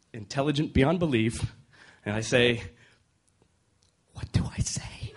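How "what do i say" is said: panicked whisper. Retold or imitated. Panicked aside to wife.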